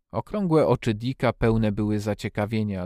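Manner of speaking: plain reading voice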